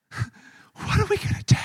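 high whispered panic with a laugh; hammed-up frightened whisper; panicked whisper. Servant whispers in terror to Elisha, high breathless whisper. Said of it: "He's like".

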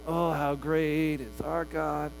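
slow, soft, lifeless sing-song; bored. Retold or imitated. Worshipper who lost the feeling, mock bored and slow. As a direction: drag it out as if tired of it. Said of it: "so easily go to".

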